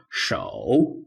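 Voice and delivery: 手 low, dipping, then rising; 手 as a Mandarin tone three demonstration; third tone. Shown as a demonstration. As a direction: dip 手 and lift its end. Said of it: "third tone".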